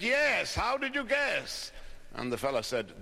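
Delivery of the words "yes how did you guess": high-pitched mock-English accent, drawn out at the end; reply in a much higher, affected Oxford English; Irishman's hammed-up English accent, pitched way up; put-on English accent. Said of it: "in his best English accent".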